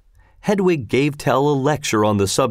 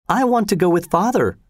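plain reading voice

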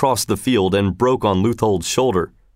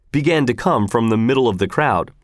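ordinary delivery, nothing marked

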